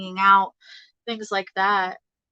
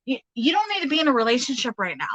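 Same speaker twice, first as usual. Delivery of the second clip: insistent, higher-pitched protest; quick, emphatic talking-to for someone else; channeled objection; 5 semitones higher, faster than usual. Acted. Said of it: "I feel somebody being like".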